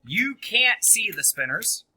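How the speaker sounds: very loud classroom announcement; calling out. Real, not acted. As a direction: project loudly to the whole class. Teacher calls out game to class.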